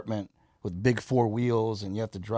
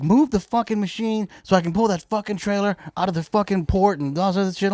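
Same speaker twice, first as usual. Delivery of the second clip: higher-pitched imitation of an irate trucker; snapping; 7 semitones higher than usual. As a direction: retell his sudden outburst, voice pitched up. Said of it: "he just snaps, dude".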